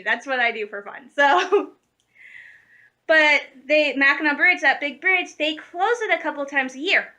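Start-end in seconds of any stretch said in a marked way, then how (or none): none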